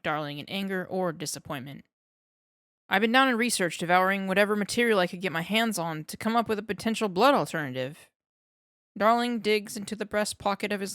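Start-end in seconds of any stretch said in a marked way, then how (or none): 2.9-8.1 fast, eager lab-nerd voice; rattling off his research at speed; eager young scientist. Acted. Darling explains eagerly.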